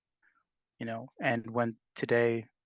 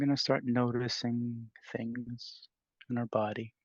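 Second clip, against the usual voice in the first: unhurried, spacious meditation voice with long pauses; guided meditation. Real, not acted. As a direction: gently guide the group into stillness. Leads a meditation.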